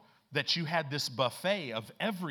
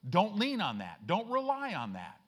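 loud, high, stretched-out preaching; full-force preaching; 6 semitones higher, 5 dB louder, slower than usual. Acted. Preacher driving the verse home to the church, loud and slow. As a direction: preach it out to the congregation, big and slow.

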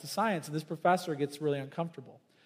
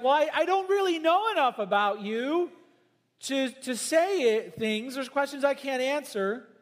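high, halting, unsure voicing; Moses unsure; 8 semitones higher, 4 dB louder than usual. Moses pleading with God, high and hesitant. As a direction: stammer out doubt like a timid Moses. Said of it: "So Moses is like".

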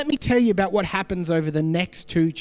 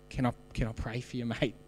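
softer, halting, repeating can I; timid quiet ask; 7 semitones lower, 11 dB quieter than usual. Retold or imitated. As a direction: tradie finally asking his workmate, shy and hushed. Nervous offer to pray, stammering.

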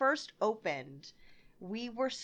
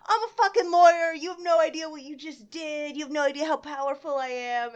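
loud, high, indignant power-trip rant; imitated screaming; 6 semitones higher, 9 dB louder than usual. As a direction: mimic an outraged customer pulling rank. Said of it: "she starts screaming at me".

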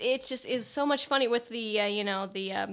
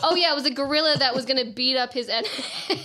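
laughing; 4 semitones higher, 8 dB louder, faster than usual. Real, not acted. Laughing while recalling scene.

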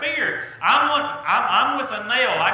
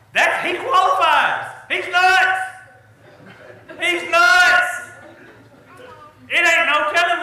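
exclaiming loudly; 7 semitones higher, 6 dB louder than usual. Acted. Preacher exclaiming about dictator.

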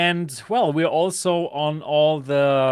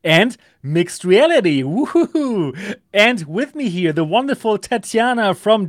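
put-on booming kids show opener; 3 semitones higher, 5 dB louder than usual. Show host opening the episode.

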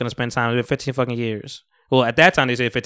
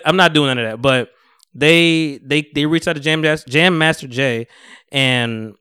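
usual speaking voice

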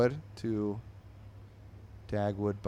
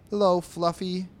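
cheery greeting voice; 9 semitones higher, 9 dB louder than usual. Acted. Man greets cat in comic.

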